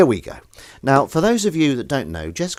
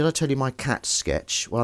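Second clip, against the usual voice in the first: ordinary delivery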